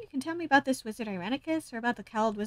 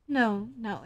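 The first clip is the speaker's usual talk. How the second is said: ordinary talk, nothing marked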